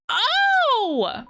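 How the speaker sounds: loud high yelp of "oh"; startled cry. Real, not acted. Player caught out after "no, no, no", startled yelp. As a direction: yelp it, pitch shooting up.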